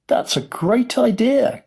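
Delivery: high, drawn-out delighted cry; excited cry. A narrator's reading. Grandpa Joe praising the invention, excited cry. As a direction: cry it out slowly, pitch soaring. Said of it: "cried Grandpa Joe".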